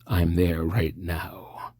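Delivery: whispered, slow and close, like a stalker on the line; creepy whisper. Acted. The mystery man whispering to a scared caller. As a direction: breathe it out slow and menacing.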